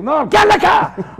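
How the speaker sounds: imitating an old man's high, loud order; insistent shout. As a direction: yell it as an order, no argument. Old man insists, overruling him.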